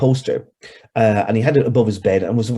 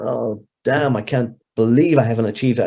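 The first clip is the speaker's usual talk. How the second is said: ordinary delivery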